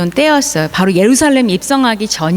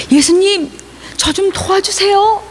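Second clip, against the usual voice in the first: high, imploring voice; pleading beggar; 9 semitones higher than usual. Acted. Bartimaeus running to Jesus for help, high plea. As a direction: plead for help in a raised pitch.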